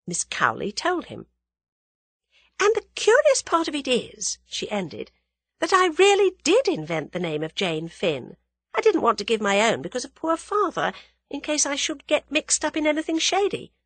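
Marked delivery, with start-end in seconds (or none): 2.6-13.7 lightly voiced young woman, higher at the edges; young woman character, confiding. Young woman explaining a false name to her friend, confiding. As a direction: confide it with a touch of animation.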